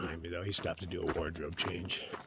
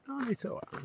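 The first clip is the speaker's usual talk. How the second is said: excited; 3 dB quieter than usual. Real, not acted. Excited about things showing up.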